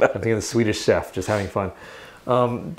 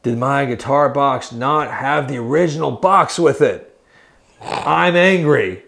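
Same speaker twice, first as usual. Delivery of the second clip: slow, drawn-out mock outrage, rising higher and louder; mock angry; 3 semitones higher, 4 dB louder, slower than usual. Customer complains about missing guitar box, hammed-up anger. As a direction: whine it like an exaggerated complaint. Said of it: "But everyone's like".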